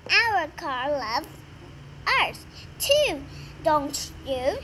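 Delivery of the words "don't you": ordinary delivery, nothing marked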